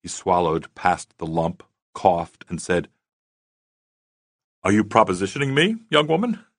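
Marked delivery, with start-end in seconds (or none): 4.6-6.5 quick, rising playful question; light teasing tone. A narrator's reading. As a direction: keep it breezy and teasing. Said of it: "He hoped his tone was as light as the words".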